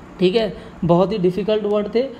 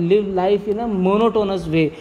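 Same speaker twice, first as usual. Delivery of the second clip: slow dictation; much slower than usual. Shown as a demonstration. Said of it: "साधु संतों वाली ज़िंदगी जीनी है".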